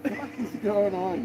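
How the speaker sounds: mock surprised outburst. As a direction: play up the shock of seeing it. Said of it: "I was like".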